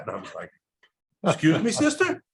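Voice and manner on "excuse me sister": high-pitched mock disbelief; taken-aback question. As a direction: act out a startled, incredulous student. Startled student answers swearing nun.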